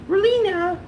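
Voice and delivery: very high-pitched name call. Real, not acted. Dad to his little daughter, high-pitched calling.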